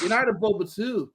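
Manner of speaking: put-on failed Irish accent. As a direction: attempt Irish, slipping towards American. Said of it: "I can't do the Irish accent".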